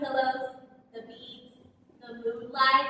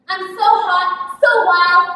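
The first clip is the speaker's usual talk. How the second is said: louder, breathless with excitement; ecstatic; 4 semitones higher, 12 dB louder than usual. Acted. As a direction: let the thrill burst out. Ecstatic memory with lover.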